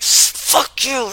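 high, loud imitated curse; angry yell. Man cursing at him and his girlfriend, imitated yell. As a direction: hurl it like the man on the street. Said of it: "this guy comes up and he's like".

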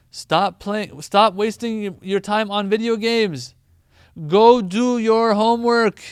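emphatic parent scolding. Retold or imitated. A parent doubling the telling-off about video games, building to a loud slow shout. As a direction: hammer the point home and end on a yell. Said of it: "Sometimes to emphasize even more".

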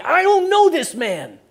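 slow, loud, high acted outburst; vehement denial. Preacher as cornered Peter swearing denial, vehemently loud. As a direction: swear it off at full voice, drawn out. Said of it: "swearing and saying".

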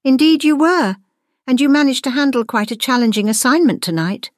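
high, approving praise; approving teacher. A narrator's reading. Teacher softening toward Gwendolyn, high and approving. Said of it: "The expression on her teacher's face softened".

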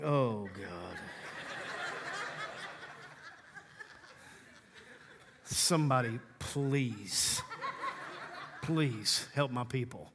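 low, soft, laughing groan of an exasperated God; weary God. Retold or imitated. Pastor voicing God in heaven, worn out by people's prayers, low and laughing. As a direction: sigh it out, fed up and amused. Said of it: "I think God is in heaven like".

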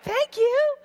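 put-on high, chirpy little-girl thank-you with a laugh; mock grateful toddler. Daughter mockingly thanking dad for the scolding, high and chirpy. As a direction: squeak it out like a pleased toddler. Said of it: "It wasn't like she was like".

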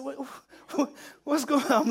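high, stammered question through laughter; laughing. Retold or imitated. Sergeant to shaking troop, laughing question. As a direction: laugh helplessly as you ask. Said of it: "I'm laughing".

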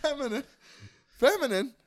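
way up high, a prissy little mock of feminine. Friend mocks being called feminine in a high mock voice. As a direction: tease him by squeaking the word high and dainty.

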